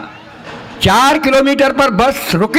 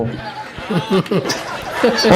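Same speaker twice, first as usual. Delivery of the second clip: laughs; 3 semitones lower, 6 dB quieter than usual. Real, not acted. Laughter at own punchline.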